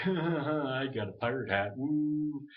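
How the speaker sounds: loud, high, drawn-out goofball mockery with a dopey laugh; mock goofy knobhead. Imitating raiders who don't take it seriously, loud mock goofiness. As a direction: play a silly raider whooping over nothing. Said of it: "the other knobheads would be like".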